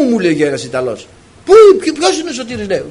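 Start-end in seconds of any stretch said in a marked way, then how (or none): 1.4-2.7 high, loud and stumbling over the words; Ποιος είναι ο Σωτήρης asked high and loud; eager question. Retold or imitated. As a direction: ask eagerly, tripping over the start. Italian eagerly asks for Sotiris.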